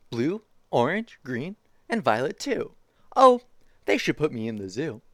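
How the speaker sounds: lively picture-book reading voice; reading to children. Acted. Streamer reading a zoo picture book to chat, bouncy storytime voice. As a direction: read it like storytime for little kids.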